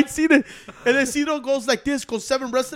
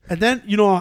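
ordinary talk

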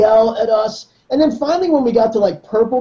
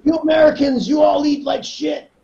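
put-on lightly accented, higher, ranting coach; Eduardo rant; 4 semitones higher than usual. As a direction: scold Americans about junk. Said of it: "he didn't want us watching YouTube".

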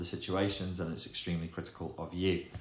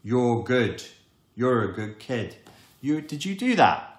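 put-on higher and louder, a beaming proud parent praising a child; good parent; 5 semitones higher, 8 dB louder than usual. Proud good parent praising their kid, high and loud. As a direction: gush praise over the kid like a delighted parent.

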